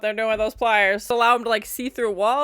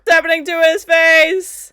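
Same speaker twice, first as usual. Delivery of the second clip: loud, high alarmed question; alarmed surprise; 7 semitones higher, 10 dB louder than usual. Real, not acted. Viewer alarmed at what's happening to his face, alarmed surprise. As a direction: cry out in shock at the sight.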